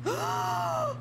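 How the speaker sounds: sudden high yelp then a sharp gasp; startled shriek and gasp. Real, not acted. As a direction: jump scare shriek. Startled by scary face.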